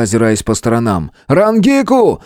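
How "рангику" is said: high, urgent call; yelling. A narrator's reading. Hero yells for the healer, urgent shout. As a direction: holler the name, pitched high. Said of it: "не переставал орать во всю глотку".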